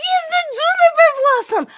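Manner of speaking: high, loud, gushing exclamation; thrilled recognition. Acted. Grendel recognizing her papa's new brew, thrilled. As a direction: gush over the name with delight.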